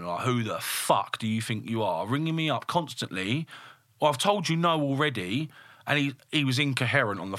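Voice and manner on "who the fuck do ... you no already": usual speaking voice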